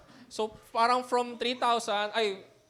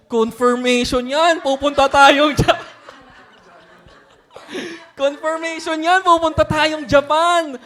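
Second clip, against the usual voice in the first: loud, slow, laughing announcement; excited; 5 semitones higher, 10 dB louder than usual. Retold or imitated. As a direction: proclaim it with glee. Retold excited claim, laughing. (masaya at malakas na pagpapahayag)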